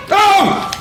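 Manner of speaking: loud high startled shout. Real, not acted. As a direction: jump and yell. Jump scare in horror game.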